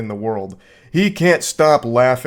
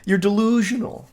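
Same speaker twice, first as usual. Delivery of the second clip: mock incredulous; 8 semitones higher than usual. Mocking the boyfriend's reply.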